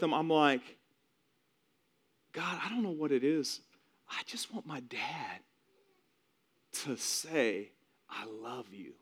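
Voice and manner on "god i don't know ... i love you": halting, slow, softening prayer; wistful plea. Retold or imitated. Son praying beside dying dad, wistful plea. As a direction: let it sink to a quiet longing. Retold prayer at dad's bedside.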